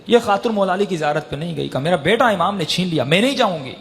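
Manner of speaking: quick, high-pitched sermon delivery; rushed fervent majlis narration; fast impassioned preaching. Acted. Preaching continues, faster.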